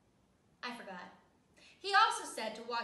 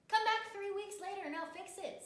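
dentist voice; 8 semitones higher, 3 dB louder than usual. Acted. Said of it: "said Doctor Fields".

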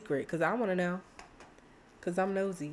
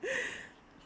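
squeaky, much higher sweet call; girl gamer voicing her Sim greeting him cutely; high cutesy Diana voice. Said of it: "She's like".

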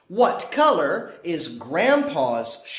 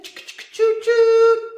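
high sung train whistle choo-choo; 13 semitones higher, 6 dB louder than usual. Pretend. Train whistle sound effect.

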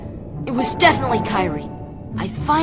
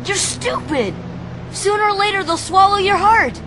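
high, impassioned outburst; heated warning. Acted. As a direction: argue desperately with a friend. Warns rival in a quarrel.

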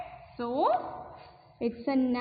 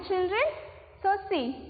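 normal talking voice

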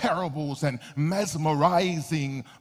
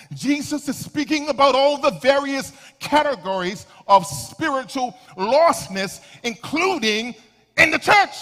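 high, loud preaching shout; 7 semitones higher, 7 dB louder than usual. Real, not acted. Preacher challenging an unresponsive church about its lostness, shouting. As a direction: build to a shouted, punched final phrase. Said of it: "let me stop shouting".

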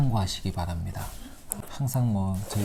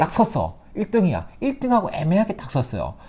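excited; 8 semitones higher, 4 dB louder than usual. Real, not acted. Retelling the spinning wheel win.

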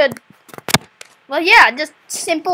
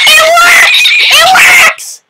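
very high, loud cheer of triumph; excited shout; 14 semitones higher, 9 dB louder, slower than usual. Real, not acted. Game finally works.

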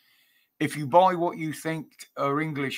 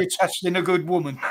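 ordinary talk, nothing marked

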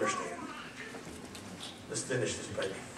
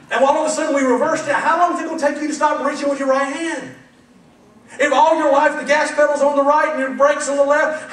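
loud, driving preacher build; preaching at full force; 8 semitones higher, 8 dB louder than usual. Real, not acted. Preacher pressing the congregation with examples, loud.

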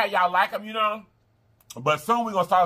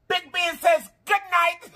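playful goodnight; 11 semitones higher, slower than usual. Acted. Playful sign-off announcement.

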